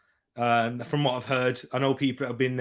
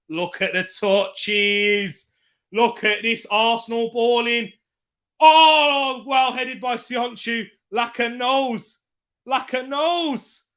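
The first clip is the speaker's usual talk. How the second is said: excited cheering; 8 semitones higher, 4 dB louder, slower than usual. Real, not acted. Live goal celebration while watching.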